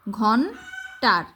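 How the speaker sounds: ঘন্টার very slow, "ghon... taar"; slow teacher's "ghon-taar"; syllable-by-syllable spelling. Shown as a demonstration. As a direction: sound out ঘন্টার with n closing the first beat. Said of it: "মূর্ধন্য প্রথম উচ্চারণ".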